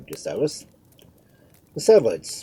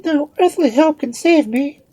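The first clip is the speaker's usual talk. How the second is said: very high, loud queen's cry; high-pitched frantic lament; despairing wail; 11 dB louder than usual. A narrator's reading. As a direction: wail it in helpless alarm.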